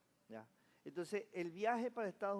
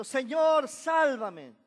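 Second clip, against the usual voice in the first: high, loud cry for help; desperate shout; 7 semitones higher, 13 dB louder, much slower than usual. A narrator's reading. Peter sinking, crying out to the Lord: a loud, high shout. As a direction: yell it like a man sinking. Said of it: "gritó diciendo".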